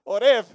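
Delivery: Horeb said loud, with no clear H; hammed-up try at Horeb; with the H, laughing. Shown as a demonstration. Pastor playfully tries the congregation's Horeb.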